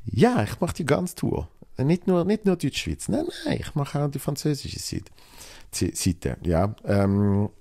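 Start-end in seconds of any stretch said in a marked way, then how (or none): 0.0-5.1 eager, pitched-up pretend circus director; imagined circus boss offer. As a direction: play the circus boss offering the whole tour, voice climbing high. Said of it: "wenn der Zirkus Knie dann kommt und mir dann sagt".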